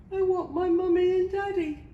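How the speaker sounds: put-on high, loud childish whine; sniffling wail. A narrator's reading. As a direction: wail it like a lost little princess. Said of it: "sniffed the little princess".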